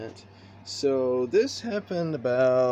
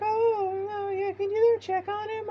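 very high falsetto mom with a mock cry. Mom begging the narrator, mock high crying voice. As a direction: whimper it like a panicked mom. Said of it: "And he's like".

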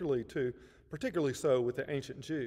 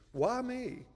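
Abraham questioning; 8 semitones higher than usual. Acted. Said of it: "I can imagine him asking".

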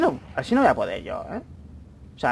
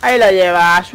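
loud, punchy cheer; celebrating shout; 10 dB louder, slower than usual. Real, not acted. Celebrating the kill to his teammate, loud cheer.

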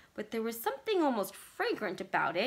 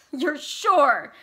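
loud, high, scornful outburst; hysterical cry; 6 semitones higher, 12 dB louder than usual. A narrator's reading. Vicky seizing on Bert's word sure, hysterical. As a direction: throw it back at him, loud and raised. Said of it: "she cried hysterically".

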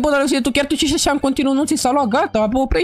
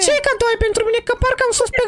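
squeaky high cartoon voice, fussing; a high, excited toy character scolding his friend; 11 semitones higher than usual. Same character, suspicious. (voce de personaj ascuțită)

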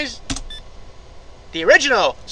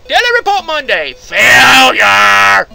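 loud mock announcer calling out the result; announcer shout; 11 dB louder, slower than usual. Gamer reading the game's failure screen aloud, announcer shout. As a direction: boom out the daily report.

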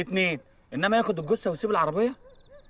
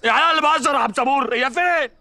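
indignant shout; 9 semitones higher, 5 dB louder than usual. Acted. Angry denial shout.

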